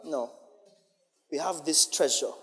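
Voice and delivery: ordinary talk, nothing marked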